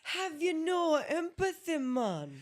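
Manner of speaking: loud, high, slow mock Jamaican; Kamala's Jamaican accent. Host copying a politician in the drinking game, loud mock Jamaican. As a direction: belt out a hammed-up Jamaican accent. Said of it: "Anytime a Kamala accent comes out".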